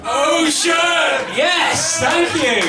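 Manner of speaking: high, very loud belted finish; triumphant shout. Acted. Comedian ending his song to the crowd, high triumphant shouting. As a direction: shout it out like the end of a big number.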